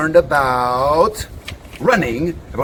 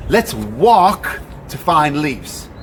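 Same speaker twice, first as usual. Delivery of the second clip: normal talking voice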